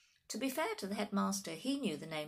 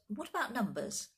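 higher, mildly rattled question; shaky; 6 semitones higher than usual. A narrator's reading. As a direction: ask while taken aback. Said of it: "in a shaky voice".

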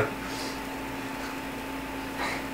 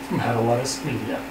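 lower, much softer trailer voice; hushed dramatic announcement; 5 semitones lower, 9 dB quieter, faster than usual. Acted. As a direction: drop it down like a teaser. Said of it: "dramatic reading".

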